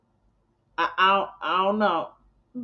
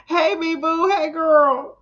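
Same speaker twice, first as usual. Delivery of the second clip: sing-song greeting; 10 semitones higher, 3 dB louder, slower than usual. Acted. Greeting viewers in put-on voice.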